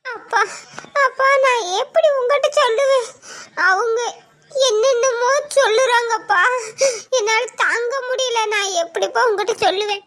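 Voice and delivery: sobbing to father; tearful, halting plea; crying. Acted. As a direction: gasp between phrases as you cry. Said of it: "அழாதம்மா".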